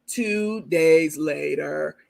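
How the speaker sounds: very slow, low SpongeBob narrator voice; cartoon narrator. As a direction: drag it out like the cartoon title card. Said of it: "they be like".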